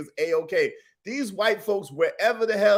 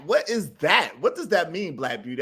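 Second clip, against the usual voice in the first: ordinary talk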